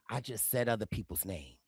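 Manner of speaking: very low, hushed confiding voice; Cirie impression. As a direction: imitate a cool schemer, deep and soft. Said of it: "Cirie like".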